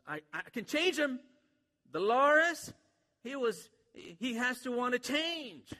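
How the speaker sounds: a hint of a Mexican country accent for Mama Butterfly; playful Mexican country accent voice. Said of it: "somewhat of a Mexican, like, country accent".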